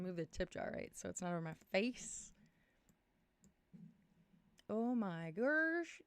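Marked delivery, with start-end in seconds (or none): 4.7-6.0 drawn-out amazement. Real, not acted. Audio setup seems to work.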